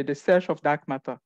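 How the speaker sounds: ordinary talk, nothing marked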